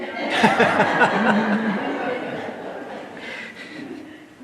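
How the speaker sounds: loud rising laugh; hearty laugh. Real, not acted. Laughs at own joke.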